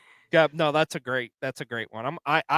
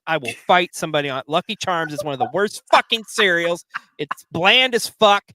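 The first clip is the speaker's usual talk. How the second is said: loud, fired-up rant, building louder; cereal rant; 2 semitones higher, 5 dB louder than usual. Real, not acted. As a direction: rant like it's a personal insult. Ranting against Lucky Charms.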